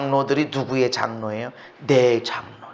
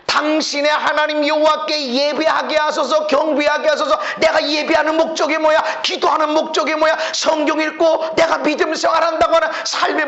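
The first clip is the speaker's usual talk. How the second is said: higher, louder rhetorical questioning; full-force preaching; 8 dB louder than usual. Acted. Preacher challenging the congregation's motives, loud and rising. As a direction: hammer the questions home.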